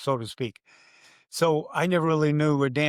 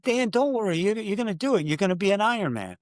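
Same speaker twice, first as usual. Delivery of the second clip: higher-pitched pep talk to a friend; retold encouragement; 6 semitones higher than usual. Announcer retelling how he reassured his nervous friend, encouraging. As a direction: buck him up, voice lifted.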